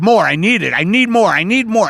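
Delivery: high, insistent demanding; mock a needy, insatiable consumer; greedy craving. Mocks a greedy consumer society.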